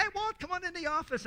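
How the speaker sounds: high, friendly call across the office; cheery colleague call. Retold or imitated. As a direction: call out to Walt like a chummy coworker. Coworkers calling him over cheerfully.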